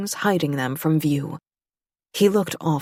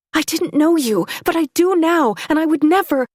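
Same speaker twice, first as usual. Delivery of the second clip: much higher and louder, voice straining; desperate plea; 11 semitones higher, 5 dB louder than usual. A narrator's reading. Claire protesting to Remy, high urgent plea. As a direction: push the denial out high and urgent.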